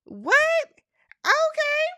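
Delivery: high, loud, baffled "What, sis?"; squeaky disbelieving double take; stunned guy reaction. Retold or imitated. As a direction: play the confused boyfriend who can't keep up. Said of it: "'cause I was him. You saying".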